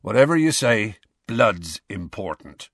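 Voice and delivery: a sad giant musing over his drink; gloomy Hagrid. A narrator's reading.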